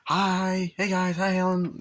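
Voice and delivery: high, loud intruding greetings; Tom Arnold. Retold or imitated. Uninvited friend greets the family, hammed-up cheer. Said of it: "Tom Arnold just invites himself in".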